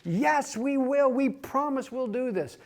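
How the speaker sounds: a hint of eager followers pledging; eager disciples. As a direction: play the keen disciples promising. Preacher voices eager disciples.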